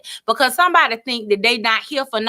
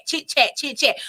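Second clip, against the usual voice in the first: ordinary delivery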